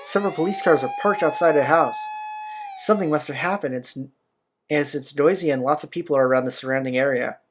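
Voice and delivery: normal talking voice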